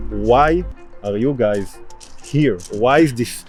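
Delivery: normal talking voice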